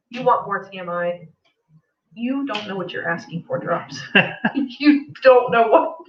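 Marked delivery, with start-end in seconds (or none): 4.5-6.0 high, loosely giggled warning; laughing warning. Real, not acted. Wife warns listeners about asking for more, laughing. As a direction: warn through rising giggles.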